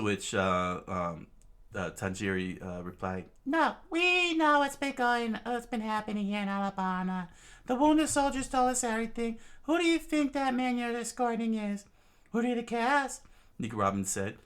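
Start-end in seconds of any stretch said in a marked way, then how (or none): 3.5-13.2 put-on high, urgent young voice; high character voice. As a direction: voice the swordsman high and pressing.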